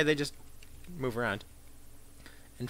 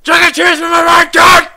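shouting; 13 semitones higher, 17 dB louder than usual. Real, not acted. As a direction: yell it out hard. Repeated spell, shouted.

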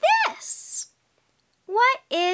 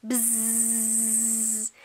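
long, low, soft buzzing; bee buzz; 6 semitones lower, 8 dB quieter than usual. Shown as a demonstration. Showing a child what a bee says, long bee buzz. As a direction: hold the zz like a bee. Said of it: "What does a bee say?".

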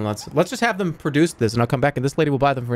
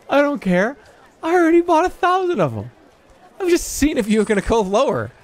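mock gloating; 12 semitones higher than usual. Player taunts the merchant after haggling, high gloating voice. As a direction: rub it in the merchant's face.

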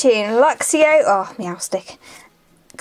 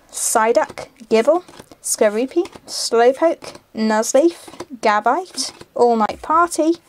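usual speaking voice

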